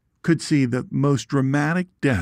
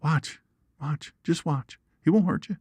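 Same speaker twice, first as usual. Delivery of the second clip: calm the frightened family down; soothing the screaming onlookers near the snake; soothing reassurance; 5 semitones higher than usual. Retold or imitated. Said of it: "I said".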